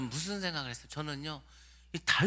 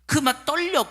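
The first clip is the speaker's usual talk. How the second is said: 1 trembling excitement; 9 semitones higher, 6 dB louder, slower than usual. Retold or imitated. Preacher acting exam nerves.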